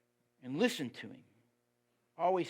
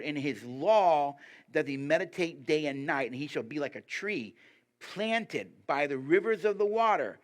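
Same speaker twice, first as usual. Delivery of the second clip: rising, louder preached reading; scripture reading; 3 semitones higher, 6 dB louder than usual. Acted. Preacher reading the psalm aloud to church, in a swelling proclaiming voice.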